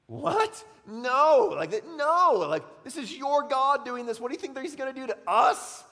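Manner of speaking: high, loud disbelieving outcry; shocked sailors. Acted. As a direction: cry out in horror at the idea. Sailors shocked at Jonah's answer.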